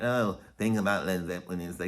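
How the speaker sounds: low, soft, badly faked Liverpool accent; lightly imitated Scouse, pitched low; attempted Liverpool accent. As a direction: have a half-hearted go at Liverpool. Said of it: "I can't do a Liverpool accent".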